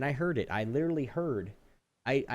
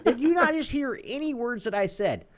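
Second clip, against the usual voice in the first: much louder, high furious yell; furious outburst; 12 semitones higher, 7 dB louder than usual. Real, not acted. Yelling about the rep's useless offer, furious and loud. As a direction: explode in disbelief. Outburst retelling rep call.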